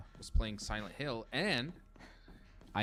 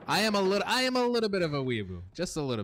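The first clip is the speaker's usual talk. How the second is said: excited confession; 8 semitones higher, 5 dB louder, faster than usual. Real, not acted. Confessing loudly to being a weeaboo.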